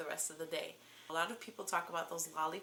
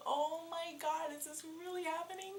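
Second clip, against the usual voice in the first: high disbelief; 10 semitones higher than usual. Retold or imitated. Crying at pregnancy news.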